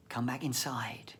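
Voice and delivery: soft whispered blessing. Real, not acted. Closing blessing to the congregation, softly whispered. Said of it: "closing whispered blessing".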